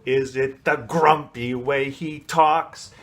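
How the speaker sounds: slow, with grumpy and talks punched on the hard consonants; grumpy consonants stressed. Shown as a demonstration. Teacher shows students how to color the word grumpy. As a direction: make "grumpy" sound grumpy through its consonants. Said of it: "making it sound grumpy with just the stressing of those consonants".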